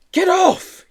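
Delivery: very high, loud squeal. Acted. Joe to the children, very high loud squeal. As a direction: squeal as they drag you. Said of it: "he squealed".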